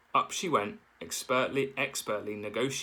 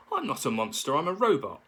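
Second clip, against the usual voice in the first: protesting; 5 semitones higher, 4 dB louder than usual. A narrator's reading. Robot protests.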